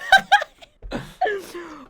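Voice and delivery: high, loud burst of laughter; helpless laugh. Real, not acted. Cracking up at own failed impression, high loud laugh. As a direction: crack up loudly.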